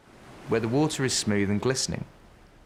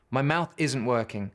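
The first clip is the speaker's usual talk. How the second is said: usual speaking voice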